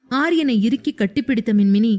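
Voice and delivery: usual speaking voice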